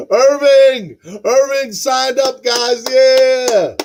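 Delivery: high, loud whoop of real excitement; excited celebration. Real, not acted. Host celebrating Irving joining the channel, excited shout. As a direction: cheer it out, drawing out the name.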